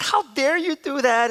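high, indignant retold yell; mock yelling. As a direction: imitate an outraged mom telling off her son. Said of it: "started yelling at me".

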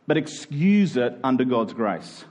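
ordinary talk, nothing marked

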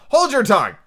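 loud, high scolding order; sharp cry. A narrator's reading. Tiger Lily silencing the Violet, loud angry cry. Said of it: "cried the Tiger Lily".

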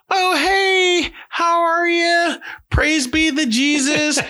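very high, slow, drawn-out phone greeting; fake-polite mom greeting, pitched sky-high; nice mom voice. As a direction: put on a syrupy representative voice for new people. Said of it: "they put that nice representative voice on".

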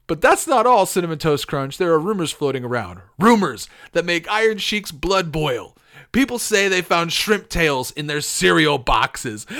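loud, worked-up wrestler promo; shouting tough-guy tirade. Acted. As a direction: build outrage at full volume. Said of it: "go with a tough voice".

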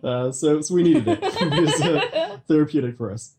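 words broken up by laughter; laughing while talking. Real, not acted. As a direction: talk on while cracking up. Both laughing about dark, sad films.

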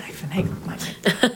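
whispered, soft and conspiratorial; whispered confession. Real, not acted. Guest admitting she cheated her way in, whispered. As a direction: whisper it like a guilty secret.

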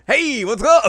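high, loud, drawn-out "hey"; perky mock greeting. Kagome pops out with a greeting, high loud mock cheer. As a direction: call it out brightly in a high, playful voice.

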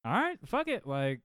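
jumps way up high in a resigned yelp; mock exasperated go-ahead, pitched very high; exasperated giving in. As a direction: throw up your hands and let him. Retold giving in.

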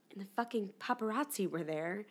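ordinary delivery

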